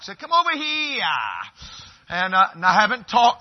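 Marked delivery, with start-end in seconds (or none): 0.2-1.0 whiny Maine accent. Shown as a demonstration. Preacher mimicking how Mainers call someone over, whiny Maine accent. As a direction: whine it like a Mainer, stretching "here" into "hee-yah". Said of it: "sometimes they whine a little bit".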